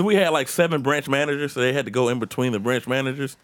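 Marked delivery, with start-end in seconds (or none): none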